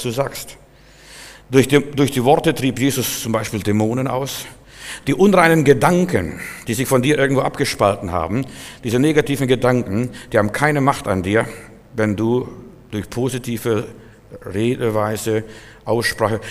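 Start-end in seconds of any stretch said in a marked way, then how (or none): none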